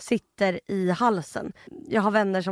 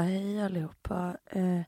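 groggy half-asleep; 2 semitones lower, 7 dB quieter than usual. Retold or imitated. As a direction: mumble like just woken from the dead. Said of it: "går upp som från de döda". (yrvaket, dåsigt och tyst)

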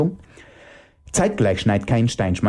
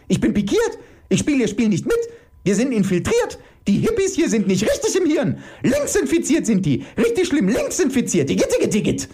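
high-pitched hysterical screeching rant; screaming; 13 semitones higher than usual. Acted. Iris ranting about infiltrated hippies, screaming. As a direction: keep screeching high, in outrage. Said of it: "schrie Iris".

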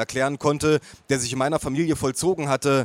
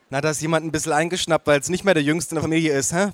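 ordinary delivery, nothing marked

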